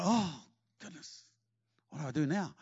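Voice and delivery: soft, mildly daunted imitation; mock dismay. David imagined facing the giant, softly mock-daunted. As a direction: let the voice sink in pretend second thoughts.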